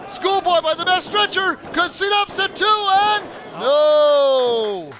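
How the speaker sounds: high, loud ringside call; excited near-fall call. Real, not acted. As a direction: yell the near fall as it happens. Near pin live call.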